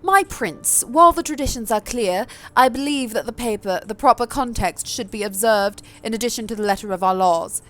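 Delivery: elegant, fancy posh lady's voice; a noblewoman pleading her case in a thick posh accent; thicker posh accent. Acted. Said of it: "I think I'll give Sophie a slightly different accent".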